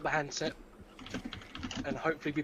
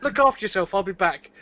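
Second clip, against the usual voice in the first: shouting goodbye through the door, louder; calling out; 3 semitones higher, 9 dB louder than usual. Acted. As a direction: call back over your shoulder. Calling out to Billy again.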